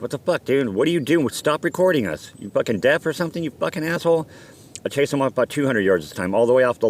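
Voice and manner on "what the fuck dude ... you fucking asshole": retold angry outburst, pitched high; acted-out irritated rant at a stranger; angry confrontation. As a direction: chew out the guy who's filming, voice raised in pitch. Confronting the man filming them.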